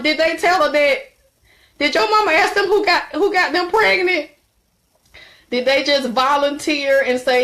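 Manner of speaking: ordinary delivery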